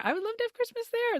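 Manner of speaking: very high, wistful sing-song; high longing. Shown as a demonstration. Repeated wish, high longing take.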